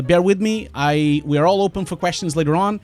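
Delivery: usual speaking voice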